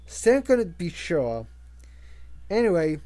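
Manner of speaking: usual speaking voice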